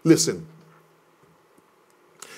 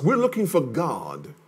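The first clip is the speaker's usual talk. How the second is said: ordinary delivery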